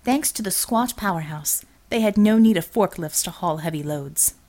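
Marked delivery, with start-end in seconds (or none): none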